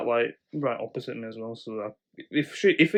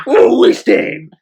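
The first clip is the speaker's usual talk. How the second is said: put-on loud, slow drunken call; hammed-up drunk woman bellowing listen; mock drunken shout; 8 semitones higher, 14 dB louder, much slower than usual. Retelling the drunk woman on the bus, loud slow shout. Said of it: "she's like".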